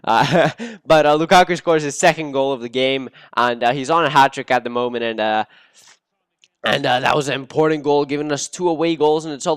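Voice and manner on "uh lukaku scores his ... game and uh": ordinary talk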